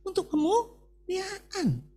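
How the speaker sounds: high, ringing pulpit cry; full-force preaching. Acted. Pastor proclaiming God's promise to the congregation, soaring preaching.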